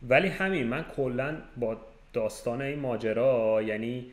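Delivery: ordinary talk, nothing marked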